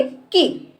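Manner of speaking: की with a short vowel. Shown as a demonstration. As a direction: keep the vowel of की brief. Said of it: "इसके उच्चारण में कम समय लगता है". (छोटी मात्रा से कम समय में की)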